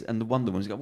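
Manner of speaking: usual speaking voice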